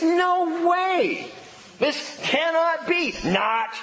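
high, emphatic rejection; indignant high-pitched no; emotional refusal. Acted. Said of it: "is an emotional response".

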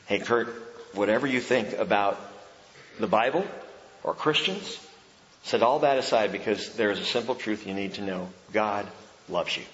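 low, soft, gentle appeal; quiet reassuring address to the critic; tender message. Pretend. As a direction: speak to him kindly, as a friend.

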